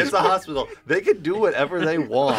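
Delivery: laughing restatement. Shown as a demonstration. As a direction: repeat it while cracking up. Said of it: "can we walk it back to what Ara just said".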